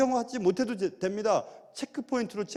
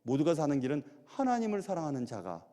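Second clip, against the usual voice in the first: ordinary talk, nothing marked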